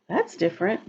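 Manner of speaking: usual speaking voice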